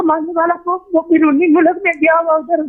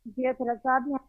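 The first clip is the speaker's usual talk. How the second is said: bad connection; 3 semitones lower, 11 dB quieter than usual. Real, not acted. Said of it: "آپ کی جگہ آواز کٹ رہی ہے".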